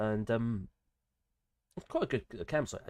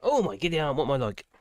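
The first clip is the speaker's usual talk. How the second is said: ordinary talk, nothing marked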